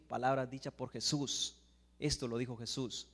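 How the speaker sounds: ordinary delivery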